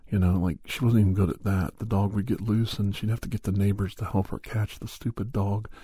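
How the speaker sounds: ordinary talk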